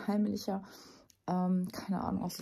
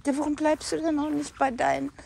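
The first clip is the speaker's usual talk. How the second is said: higher-pitched exasperated reproach; scolding; 6 semitones higher, 4 dB louder than usual. Real, not acted. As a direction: chide a straying animal, voice raised in pitch. Scolding the stray animal. (vorwurfsvoll, mit höherer Stimme)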